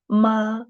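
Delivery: "Ma" held high and level; first tone. Shown as a demonstration. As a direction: sustain "Ma" on a high note. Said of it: "the four tones".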